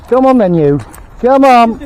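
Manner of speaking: loud, high come-on call; calling the dog. Real, not acted. Owner calls his dog to come, loud and high. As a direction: urge the dog to follow.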